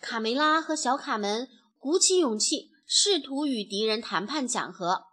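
usual speaking voice

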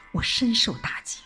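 ordinary delivery

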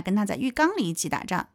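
normal talking voice

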